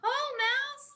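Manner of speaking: high cheerful greeting; Hare voice. A narrator's reading. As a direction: pitch way up for Hare.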